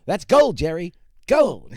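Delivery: loud, high, slow sing-song punch; Banya cadence. Shown as a demonstration. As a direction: lean into the catchphrase rhythm. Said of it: "let's bring back the cadence".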